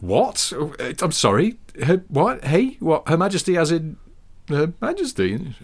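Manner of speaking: mock-shocked, stammering disbelief; startled disbelief. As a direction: react like you just heard the Queen is next door. Friend's shocked reaction retold.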